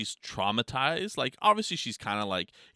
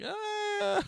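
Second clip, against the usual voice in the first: 1 stunned uh. Retold or imitated. Reacts to daughter's "yep.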